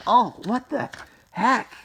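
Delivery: loud, high exclamation of disbelief; drawn-out, startled squeal at yourself; baffled. Real, not acted. Host startled by own squeaky voice, baffled.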